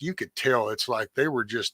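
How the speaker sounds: normal talking voice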